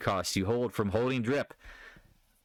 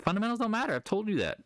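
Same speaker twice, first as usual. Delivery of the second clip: exasperated insistence; 11 semitones higher, faster than usual. Real, not acted. Insisting fundamentals don't matter.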